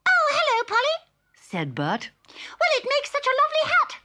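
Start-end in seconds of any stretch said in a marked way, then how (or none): 0.1-1.0 squeaky very high little creature voice; Bert the Tumbledowny. Acted. Bert greets Polly innocently, squeaky very high. 2.6-4.0 squeaky very high little creature voice; Bert the Tumbledowny. Acted. Bert greets Polly innocently, squeaky very high.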